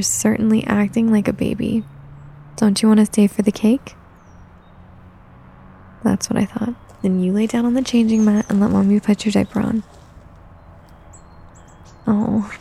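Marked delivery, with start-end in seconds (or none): none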